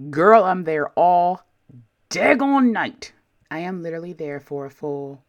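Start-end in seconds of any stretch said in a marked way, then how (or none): 0.1-3.1 exasperated drawn-out complaint. Real, not acted. Exasperated emphasis on long wait.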